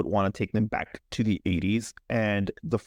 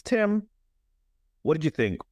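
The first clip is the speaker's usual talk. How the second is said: normal talking voice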